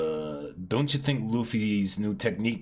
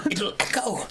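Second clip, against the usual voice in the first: whisper; 6 semitones higher than usual. Acted. Hushing so nothing echoes.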